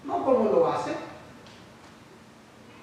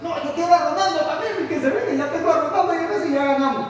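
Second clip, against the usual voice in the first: put-on higher, louder, fast boyish bragging; excited team picker; 5 semitones higher, 4 dB louder, faster than usual. Church group member grabbing Ronaldo and Messi for his side, excited and fast. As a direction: brag like a kid picking his team. Child picking football players.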